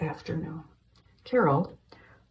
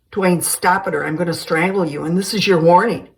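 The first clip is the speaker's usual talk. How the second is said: loud angry warning; threat; 10 dB louder than usual. Acted. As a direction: growl a threat, getting louder. Steve threatens Dwayne.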